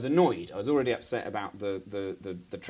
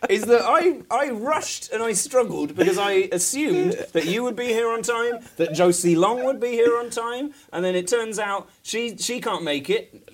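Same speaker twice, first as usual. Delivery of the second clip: higher, much louder, laughing indignant rant; mock outrage; 9 semitones higher, 9 dB louder than usual. A guest ranting at the late co-host in mock fury. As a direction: blame the co-host in a hammed-up fury.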